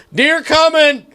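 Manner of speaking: high call of a deer pusher; shouting. Retold or imitated. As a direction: yell the warning across the drive. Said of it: "the guy that's pushing goes".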